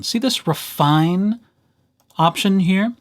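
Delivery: normal talking voice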